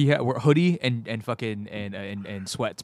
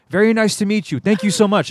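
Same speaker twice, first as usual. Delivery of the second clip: high, louder courteous imitation; Anderson Silva; 7 semitones higher, 6 dB louder than usual. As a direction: put on the fighter's high, polite voice. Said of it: "but in his voice".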